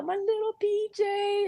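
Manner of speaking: put-on high, doting baby-talk coo; mock doting voice for a sweet little boy; cooing adult. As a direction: coo it like you're pinching his cheeks. Cooing over a cute boy.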